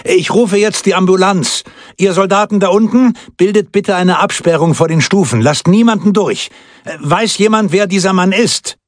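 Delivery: high, projected official orders; sustained high-pitched public announcement; announcing to the crowd. A narrator's reading. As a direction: take charge of the crowd, pitched well up. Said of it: "und erklärte".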